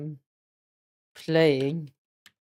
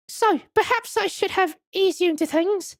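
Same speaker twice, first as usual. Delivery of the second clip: put-on high, French-accented madam, politely welcoming; much higher voice with a French accent; French museum madam. Madam introducing the wax museum to visitors, high French accent.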